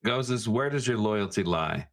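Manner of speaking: ordinary talk, nothing marked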